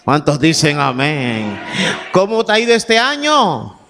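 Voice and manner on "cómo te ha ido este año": full-force preaching. Acted. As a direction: preach at them full force, calling out every question. Preacher building to full-force exhortation.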